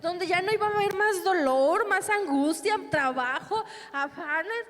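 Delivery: normal talking voice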